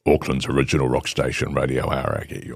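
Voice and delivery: deep, rounded radio ident read; low announcer voice for a rock station. Shown as a demonstration. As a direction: sell the station name like a DJ.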